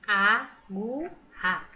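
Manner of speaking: "Agu-ra" drawn out slowly in separate pieces; "Agu-ra" slow, every letter voiced; sounding out letters. Shown as a demonstration. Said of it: "eu pronuncio todas as letras".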